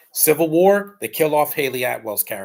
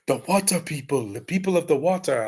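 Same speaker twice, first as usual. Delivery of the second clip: imitating a movie character, higher-pitched; M'Baku; 5 semitones higher than usual. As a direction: quote the film character in a put-on voice.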